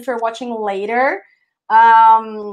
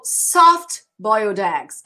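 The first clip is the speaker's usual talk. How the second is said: slow teacher drill; slow model; much slower than usual. Shown as a demonstration.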